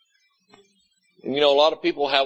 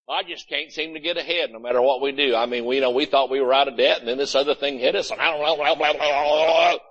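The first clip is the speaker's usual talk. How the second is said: fast grumble dissolving into babbled blahs; mock defeated complainer; faster than usual. Preacher mimicking the attitude of failure, mock-defeated rambling. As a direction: rattle off the complaint like tired old excuses. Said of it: "That's the attitude of failure, defeat".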